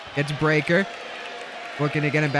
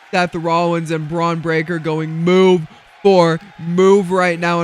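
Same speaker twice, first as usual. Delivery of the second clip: ordinary talk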